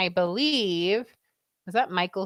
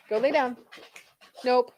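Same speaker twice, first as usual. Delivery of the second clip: ordinary delivery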